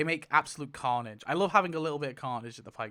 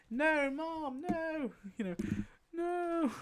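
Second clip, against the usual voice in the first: high, soft pleading no; whiny son voice; 11 semitones higher, 5 dB quieter, slower than usual. Retold or imitated. Herschel pleading with his mom in a high whine. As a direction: beg like a small boy to his mother. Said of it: "Herschel Reed's like".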